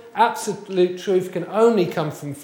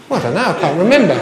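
laughing admission; 4 semitones higher, much faster than usual. Retold or imitated. Admitting he forgot the exercises.

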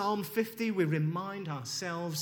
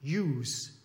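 lightly imitated Glasgow "yous"; Glaswegian accent; 3 semitones lower, 4 dB louder than usual. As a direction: imitate a Scottish friend's Glaswegian vowel. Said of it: "I have a Scottish friend. He's from Glasgow".

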